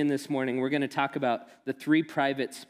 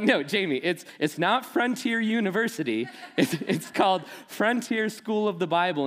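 laughing protest; 6 semitones higher than usual. Retold or imitated. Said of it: "I'm like".

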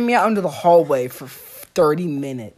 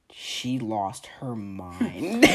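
very slow, low and hushed; dramatic slow reveal; 10 semitones lower, 10 dB quieter, much slower than usual. Real, not acted. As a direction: draw each word out for suspense. Dramatic climax of the story.

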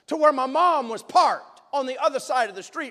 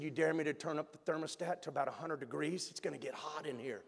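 much softer, low and quick, a schoolboy's secret dare; sneaky dare; 11 semitones lower, 16 dB quieter, faster than usual. Retold or imitated. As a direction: keep it down so the teacher can't hear. Classroom dare, hiding from teacher.

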